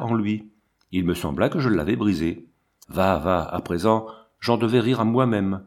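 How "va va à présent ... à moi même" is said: ordinary delivery, nothing marked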